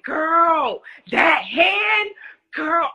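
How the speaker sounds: high, loud, excited mom voice; mama hyped about the hand; high-pitched loud mom impression; imitating mom. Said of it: "She was like".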